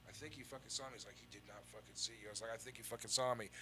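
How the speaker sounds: retold hushed back-and-forth while hiding; hushed urgency. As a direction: keep it low, as if afraid of being overheard. Hushed exchange while hiding from father.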